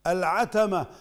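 العتمة with three short a vowels; three fathas. Shown as a demonstration. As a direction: say العتمة as al-ʿatama, every vowel an open a. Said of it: "بفتحات ثلاث". (العتمة بفتحات ثلاث)

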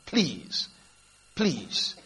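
soft, low pleas with pauses between; hushed, beaten-down retold plea; begging. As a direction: quietly imitate someone begging. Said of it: "He had to come begging this boy".

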